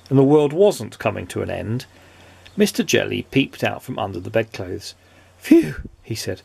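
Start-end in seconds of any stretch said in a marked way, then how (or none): 5.4-5.8 high-pitched puff of relief; relieved phew. A narrator's reading. Mister Jelly peeping out from bedclothes, relieved.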